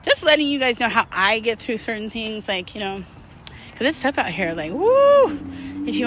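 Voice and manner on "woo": high, loud "woo" of disbelief; woo exclamation. Real, not acted. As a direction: blow out a big "woo" at how hard it's been. Exclaims how tough life is.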